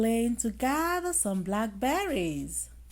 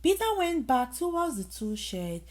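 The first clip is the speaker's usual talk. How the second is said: usual speaking voice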